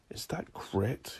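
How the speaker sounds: slow, hushed whisper; whispered thought. A narrator's reading. Declan wonders about the stuck key, whispering. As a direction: whisper it to yourself, slowly.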